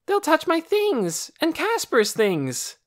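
high, loud, slow complaint; upset cry. A narrator's reading. Boy afraid guests will touch his things, upset cry. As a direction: plead against it, near tears. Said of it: "cried Torin".

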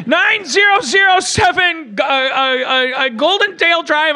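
high, sobbing shout of numbers; crying out the address; panicked caller. Acted.

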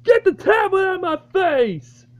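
high angry yell; shrieking at the filmer; shouting. Real, not acted. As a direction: yell to get the tablet away. Yelling at the camera.

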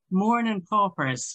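put-on drawn-out Irish-accent take on "Morning paupers"; mock Irish accent. Lecturer quoting a mother's letter that mocks the Irish accent, mock Irish accent. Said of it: "The Irish accent is dreadful".

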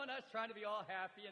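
normal talking voice

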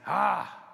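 a loud exasperated "Ah!" voicing God's anger; angry huff. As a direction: huff it out like a heavy angry breath. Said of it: "God is saying".

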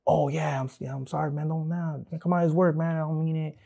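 imitated apology in a high voice; apologetic boss. Ex-worker mimics boss excusing himself after confrontation, high apologetic imitation.

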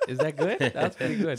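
ordinary talk, nothing marked

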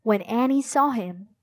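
ordinary delivery, nothing marked

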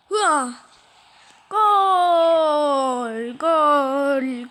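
loud goal scream; screaming football commentator. Acted. Celebrating a goal.

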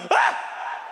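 loud shriek of fright; startled scream. Retold or imitated. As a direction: yell out as if jumped from behind.

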